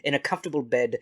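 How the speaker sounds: ordinary talk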